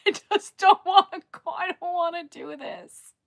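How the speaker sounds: high, loud, tearful plea; real crying breaking into the words; choked-up wail of not wanting to; on the verge of tears. Real, not acted.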